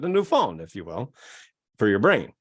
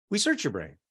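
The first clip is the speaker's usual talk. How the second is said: usual speaking voice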